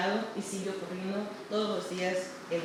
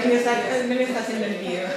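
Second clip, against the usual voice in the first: fast, laughing through words; amused, words tumbling out; 3 semitones higher, 7 dB louder, faster than usual. Real, not acted. Laughing about being filmed.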